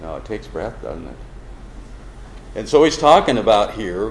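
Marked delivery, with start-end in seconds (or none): none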